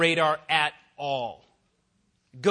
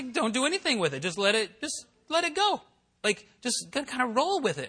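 higher woman's voice; 7 semitones higher than usual. Retold or imitated. College worker voiced.

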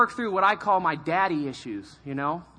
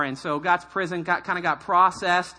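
ordinary delivery

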